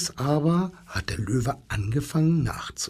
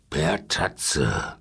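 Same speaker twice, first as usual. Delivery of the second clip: much lower growled lion line; growling lion; 10 semitones lower than usual. Acted. Lion answers the zookeeper, low storybook growl. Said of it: "grollte der Löwe".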